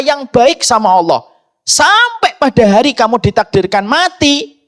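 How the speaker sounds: high-pitched, driving sermon voice; impassioned preaching. Real, not acted. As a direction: preach it insistently, pitched well up. (berkhotbah dengan nada tinggi dan berapi-api)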